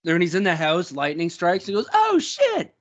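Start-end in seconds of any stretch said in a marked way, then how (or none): 1.9-2.7 high-pitched, flamboyant exclamation; super high-pitched. Retold or imitated. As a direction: imitate a movie star's squealed line, very high. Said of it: "But it's super high-pitched".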